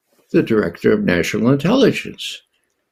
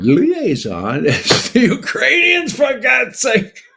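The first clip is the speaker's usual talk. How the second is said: laughing so you don't cry; exasperated laughing shout; 12 semitones higher, slower than usual. Real, not acted. To listeners about the Ukrainians as liaison, an exasperated laughing shout. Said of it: "I have to laugh".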